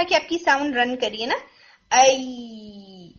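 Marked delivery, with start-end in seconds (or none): 1.9-3.1 long, drawn-out "ائی" that keeps flowing; running sound demo. Shown as a demonstration. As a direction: stretch "ائی" out so the sound runs on. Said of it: "دیکھیے sound رن کرتی ہے".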